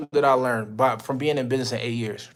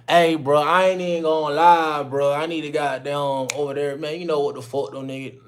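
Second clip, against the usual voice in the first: casual street talk; 3 semitones higher, 5 dB louder than usual. Retold or imitated. Mentor mocking how clients talk, loud casual street slang. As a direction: talk sloppy like you don't care how you come off. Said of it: "like y'all not worth nothing".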